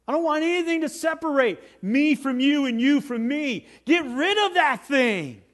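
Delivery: high, urgent preacher's plea; impassioned preaching. Acted. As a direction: plead at full force, pitched high. Preaches as Jesus pleading.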